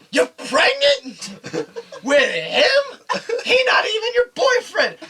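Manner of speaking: high, loud shocked-mother outburst; outraged disbelief. Retold or imitated. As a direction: act out an appalled mother, voice shooting up. Girlfriend's shocked mom, acted out.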